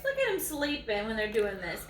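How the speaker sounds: ordinary delivery, nothing marked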